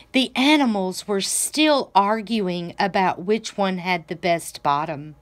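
ordinary delivery

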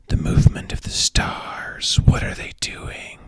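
hushed mystic whisper; mystical whisper. Acted. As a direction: whisper it like a seer. Astrology forecast whispered.

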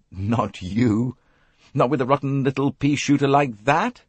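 lightly voiced hearty Englishman making light of it; dismissive chap. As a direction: scoff gently to comfort her.

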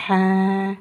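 "ha." with the a drawn out evenly; long vowel. Shown as a demonstration. Teacher links letter and long vowel, "ha." held long. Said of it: "avec la voyelle longue".

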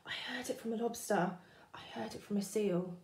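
soft, fast whisper. Acted. Fish passing on the rumour, whispered. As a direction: pass it on as a secret whisper.

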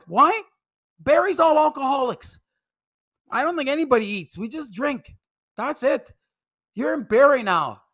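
ordinary delivery

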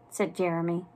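usual speaking voice

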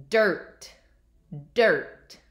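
DIRT said alone, loud and falling; careful word model. Shown as a demonstration. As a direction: punch out DIRT for young listeners. Teacher models test word for listening.

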